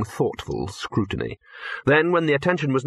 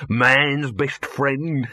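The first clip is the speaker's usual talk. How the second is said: very slow, voiced showman's slogan; grand sales slogan; 3 semitones higher, much slower than usual. Dibbler pitching the wonder dog, slow grand slogan. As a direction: roll out each word grandly.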